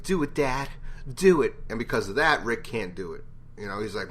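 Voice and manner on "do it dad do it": put-on urging kid. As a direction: egg his dad on. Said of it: "Carl walks in and he's like".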